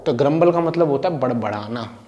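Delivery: usual speaking voice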